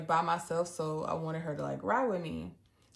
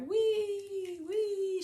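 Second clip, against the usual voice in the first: gleeful whee; 12 semitones higher than usual. Retold or imitated. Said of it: "going out there like".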